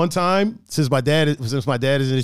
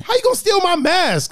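indignant quote; 14 semitones higher, 4 dB louder than usual. Retold or imitated. Outraged retelling of stolen mask.

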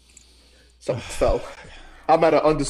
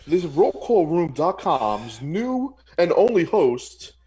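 announcer voice; 4 semitones higher than usual. Acted. Mock host announcement.

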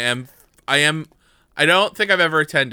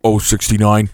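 second take, lower and punchy; bingo caller; 5 semitones lower, 5 dB louder than usual. Pretend. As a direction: call it again, cleaner, no laugh. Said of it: "isolate that audio".

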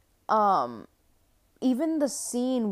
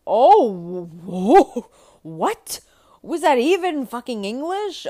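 real startled yell, loud and high; jolted out of her story by a racket; startled outburst; 5 semitones higher, 10 dB louder than usual. Real, not acted. Interrupted mid-story by a noise, shouting in real shock.

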